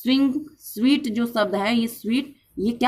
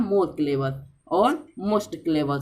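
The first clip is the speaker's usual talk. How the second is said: slow demo; 5 semitones lower, much slower than usual. Shown as a demonstration. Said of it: "एक clever ऐसे बोला जाता है".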